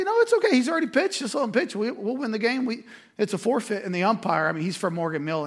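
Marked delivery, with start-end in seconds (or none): 0.0-3.8 much higher, excited and loud; young coach insisting to the ump, high and eager; excited insistence. Retold or imitated. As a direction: insist brightly, riding high. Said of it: "I say".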